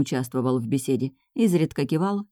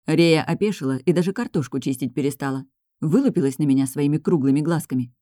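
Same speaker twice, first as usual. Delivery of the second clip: ordinary delivery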